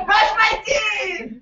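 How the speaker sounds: high, slow, playful exclaim; excited exclaim. Real, not acted. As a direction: cheer the answer back. Exclaims the answer to the audience.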